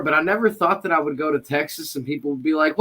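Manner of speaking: ordinary talk, nothing marked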